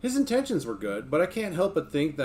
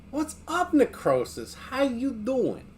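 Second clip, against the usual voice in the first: high, stretched greeting; 10 semitones higher, slower than usual. Real, not acted. Streamer greeting a chat viewer, high and stretched. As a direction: greet him high and slow.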